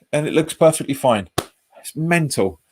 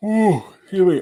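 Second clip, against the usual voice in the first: yawning; 7 semitones higher than usual. Real, not acted. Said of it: "I don't know why I'm yawning so much".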